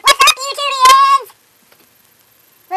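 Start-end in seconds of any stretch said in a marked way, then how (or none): 0.1-1.3 loud, high shouted hello; hammed-up greeting. Vlogger greeting the YouTube viewers, hammed-up shout. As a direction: call out a big excited welcome.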